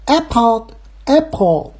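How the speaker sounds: Apple high and very slow, second syllable fading; front stress, fading end. Shown as a demonstration. Practising the correction, slow front-stressed Apple. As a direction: punch the start of Apple, let the end fade. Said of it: "它后边就淡了".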